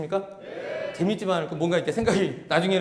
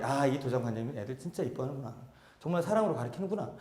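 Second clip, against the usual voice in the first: impressed murmur; 7 semitones lower, 10 dB quieter than usual. Retold or imitated. Parent's impressed thought.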